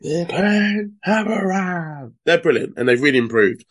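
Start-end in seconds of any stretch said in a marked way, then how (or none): 0.0-2.1 slow drawn-out catchphrase call. Retold or imitated. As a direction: announce it like a ring entrance. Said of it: "as they say".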